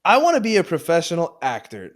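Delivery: loud, high cheeky answer; teasing loud answer. Pretend. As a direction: say it loud to tease him. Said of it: "You motherfucker".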